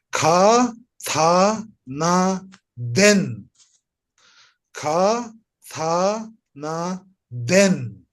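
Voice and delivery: Ka, tha, na, den read syllable by syllable; teacher giving the line to repeat; read-after-me demo. Shown as a demonstration. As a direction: say each syllable clearly for the class to copy. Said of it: "you can read after me".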